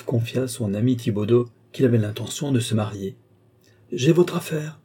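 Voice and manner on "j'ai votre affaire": eager exclamation. A narrator's reading. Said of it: "s'écria Thibaudeau".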